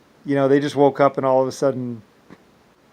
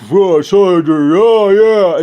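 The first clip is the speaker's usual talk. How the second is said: taunting; 6 semitones higher, 10 dB louder, much slower than usual. Retold or imitated. Enemies taunting retold.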